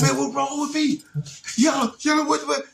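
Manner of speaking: put-on a panicked kid yelping for help; scared panic. Boy frightened by his body, yelping high-pitched panic. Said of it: "Like, like that".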